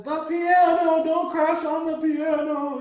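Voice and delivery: high, loud, slow panicked cry; alarmed dance teacher. Acted. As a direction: shriek like a teacher watching her piano. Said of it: "then she says".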